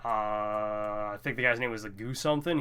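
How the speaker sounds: normal talking voice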